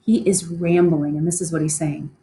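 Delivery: ordinary talk, nothing marked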